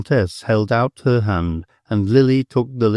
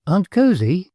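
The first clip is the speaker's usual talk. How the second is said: bright, raised call of a name; sudden rising call; sudden exclamation; 9 semitones higher than usual. A narrator's reading. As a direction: blurt the name out on a lift. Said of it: "she suddenly exclaimed".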